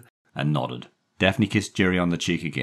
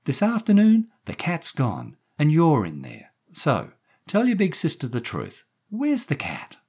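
high, louder woman's voice; coaxing woman; 13 semitones higher, 5 dB louder than usual. A narrator's reading. Daphne questioning the boy about the cat, coaxing. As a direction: press him gently for an answer.

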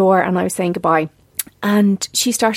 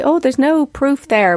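mock dismissive expert, higher and slow; sceptical expert; 7 semitones higher, slower than usual. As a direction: lightly mimic a doubting nutritionist. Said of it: "some dietitians and nutritionists will say".

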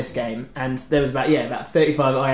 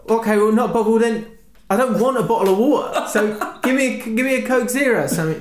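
exasperated; 6 semitones higher than usual. Retold or imitated. Arguing with bar woman.

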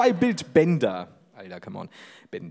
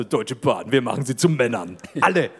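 deep ad voiceover; 6 semitones lower than usual. Pretend. Ad voiceover slogan.

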